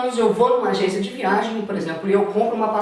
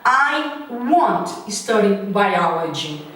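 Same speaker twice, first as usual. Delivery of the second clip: very slow, stretching won't; slow model contraction; 3 semitones lower, much slower than usual. Shown as a demonstration. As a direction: draw it out for students. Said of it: "se tornará". (contração dita bem devagar)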